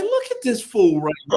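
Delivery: high, amused disbelief at a show-off; mocking amazement. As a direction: scoff at a ridiculous classmate. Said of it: "I'm like".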